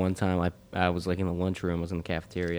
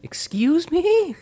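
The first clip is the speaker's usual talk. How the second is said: high, loud, drawn-out shocked voice; stunned caller; 10 dB louder, much slower than usual. Retold or imitated. Spam caller answering the screaming teen, stunned and shrill. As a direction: stretch it out high and affronted.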